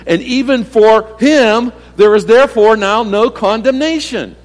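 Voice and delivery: fiery pulpit delivery; preaching at full force. Acted. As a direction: drive each point home to the congregation. Preacher rising to climax.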